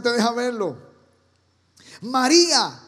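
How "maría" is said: louder, called out tenderly; calling her name. Retold or imitated. Risen Jesus calling Mary, louder. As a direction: call her name across the garden.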